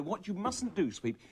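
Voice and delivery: ordinary talk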